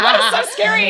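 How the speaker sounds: high, loud mock wail with laughter; hammed-up frightened child's wail; mock crying. Scared child explaining to mom, mock crying. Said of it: "Why are you crying".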